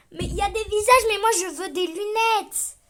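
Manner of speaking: high, loud indignant protest; loud, high whiny protest; exasperated complaint. Real, not acted. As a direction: complain loudly at the screen. Wrong search results, frustrated.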